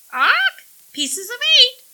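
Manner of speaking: very high, loud parrot screech; snickering parrot. A narrator's reading. Parrot Long John mocks Millie's question, snickering squawk. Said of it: "he snickered".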